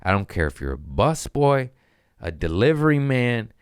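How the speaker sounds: normal talking voice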